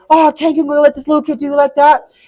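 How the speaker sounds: mock goading from the crowd; heckling entourage. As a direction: egg him on like his crew. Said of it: "he had all of his entourage around the ring".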